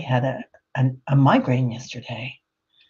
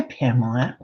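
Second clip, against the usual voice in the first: normal talking voice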